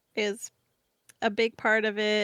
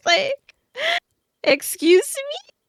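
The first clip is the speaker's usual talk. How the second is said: much higher, shocked squeal; stunned disbelief; 7 dB louder, slower than usual. Real, not acted. Disbelief at the donation total.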